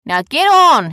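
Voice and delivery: loud, high command; brash hollered instruction. Acted. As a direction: order them aboard from the deck.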